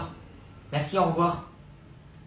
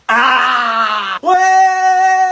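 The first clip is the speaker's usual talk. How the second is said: loud excited yell; cheer; 6 semitones higher, 8 dB louder than usual. Acted. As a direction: celebrate like a win. Cheering in class.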